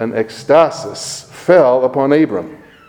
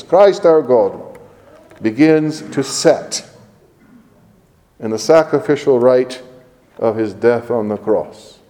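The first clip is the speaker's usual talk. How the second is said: ordinary talk, nothing marked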